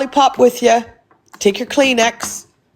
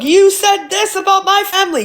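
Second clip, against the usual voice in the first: mocking high voice; 10 semitones higher than usual. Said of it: "he goes and look".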